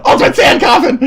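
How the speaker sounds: high, loud anime attack call; shouted move name. Retold or imitated. Gamer taunting his friend, shouting a high, loud attack. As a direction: bellow it like a finishing move. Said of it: "but I kept saying".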